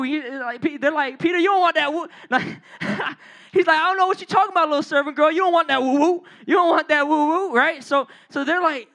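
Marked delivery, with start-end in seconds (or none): 1.2-2.1 higher-pitched mock Chicago street talk. Bystanders teasing Peter in Chicago slang, mock Chicago swagger. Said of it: "what do people in Chicago usually say". 3.8-7.5 raised, hammed-up Chicago street voice; Chicago street talk. Peter brushing off the servant girl, mock Chicago street talk. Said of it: "what do people in Chicago usually say".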